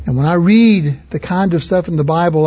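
ordinary talk

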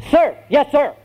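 high, barked recruit reply; drilled recruit. Acted. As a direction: snap back an instant answer to the sergeant. Drilled recruit answers his sergeant.

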